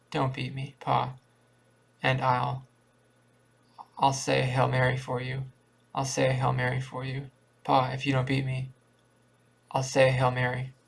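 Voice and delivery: plain reading voice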